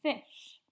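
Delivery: teacher blending sounds into fish for the class; blended word. Shown as a demonstration. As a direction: land fish brightly, putting onset and rime together. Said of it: "Put it together, we have".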